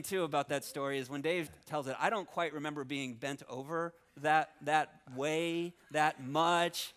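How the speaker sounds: normal talking voice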